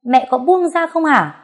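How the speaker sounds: sharp, loud and high, losing his temper; a son snapping at his mother; angry outburst. A narrator's reading. Son angrily tells mother to let go. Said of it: "rồi cũng cáu lên".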